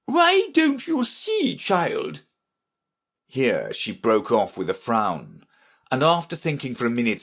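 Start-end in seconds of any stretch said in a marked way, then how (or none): 0.0-2.2 very high, slow imperious voice; scolding Red Queen. Acted. Red Queen to Alice, high scolding. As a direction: stretch it out in high-pitched reproof.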